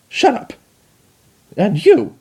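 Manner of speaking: high, loud barked orders; furious commands. Acted. Chrysalis barking at the Dalek and spy, high and furious. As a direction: snap each order.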